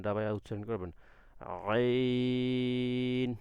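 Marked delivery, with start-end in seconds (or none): 1.7-3.3 আইন drawn long from deep in the throat; deep throat ain. Shown as a demonstration. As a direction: hold আইন long and press it from mid-throat. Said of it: "ডাবায়া, আগে যত পারেন ডাবাইবেন". (গলার মাঝখান থেকে চেপে লম্বা আইন)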